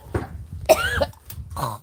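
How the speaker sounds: long retching cough, high and strained; gagging cough. Real, not acted. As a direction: cough and gag on a hair. Hair stuck in throat.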